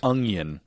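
"onion" said as UNG-yun. Shown as a demonstration. Cohost showing own pronunciation, "onion" as ung-yun. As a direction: put a hard ng in "onion". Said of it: "which [name] says".

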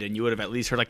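ordinary talk, nothing marked

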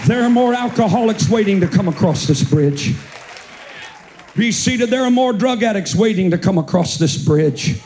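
full-throated revival preaching, almost singing; preaching at full force. Acted. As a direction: carry the church up to a chanted peak. Preacher builds to sung climax.

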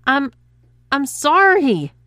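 strained, high and loud through pain; apology squeezed out in pain; wincing. A narrator's reading. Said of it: "winced Adam".

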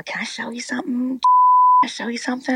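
put-on old preacher voice. An old preacher asking his host, lightly voiced eager plea. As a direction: beg sweetly to show her something. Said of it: "And he says".

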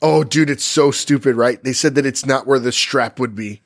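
usual speaking voice

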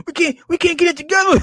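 high, rushed imitation of outrage; mock heated. Vlogger imitating a heated man's complaint, rushed mock outrage. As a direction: squeal his complaint fast, amused. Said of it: "He's heated".

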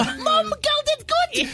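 very high-pitched celebrity impression. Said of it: "her speaking voice ... but when you hear".

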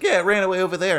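high, loud mock reply; hammed-up offhand answer; imitated casual bystander. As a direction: call it out as a mimicked onlooker. Mimics guy answering about the dog.

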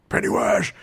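high, mock strained yell; half-voiced imitation of a yell; Bill. Host imitating Bill yelling into the sewer, mock strained yell. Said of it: "he's, like, yelling at".

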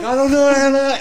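high-pitched panicked babble; mock panic. Intern on the line while fleeing, mock-panicked. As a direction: voice shoots way up, acting out helpless panic.

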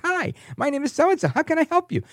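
very high-pitched, over-cheerful customer service voice; mock an all-smiles greeter, way up high; ecstatic phone rep. Imitating the rep greeting a caller, ecstatic. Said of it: "And she's ecstatic".

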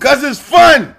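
loud, high yell; shouted punchline. Acted. Answering why he'd punch the dude, yelling. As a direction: yell it like it's obvious.

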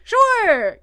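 high, loud eager "sure"; eager date. Retold or imitated. Date eagerly agreeing to the bathroom request, high and loud. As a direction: chirp it back too keen.

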